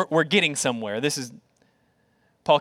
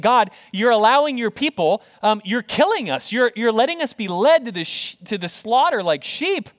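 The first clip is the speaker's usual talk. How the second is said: higher, pleading cry to God; crying out in lament; 5 semitones higher, 3 dB louder than usual. Acted. Psalmist laments to God over his people, crying out. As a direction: protest to God in an acted lament. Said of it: "he's crying out and he's saying".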